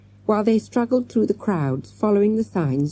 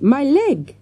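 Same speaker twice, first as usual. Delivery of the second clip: higher, louder pained cry; 9 semitones higher, 5 dB louder than usual. A narrator's reading. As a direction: cry out clutching the hurt leg. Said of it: "she cried".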